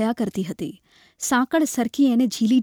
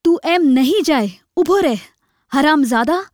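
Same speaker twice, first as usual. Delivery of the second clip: loud, raised-pitch angry shout; furious threat; 4 semitones higher, 4 dB louder than usual. A narrator's reading. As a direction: bellow a threat and curse. Man threatens beggar with a stick. (ગુસ્સાભરી મોટી ધમકી)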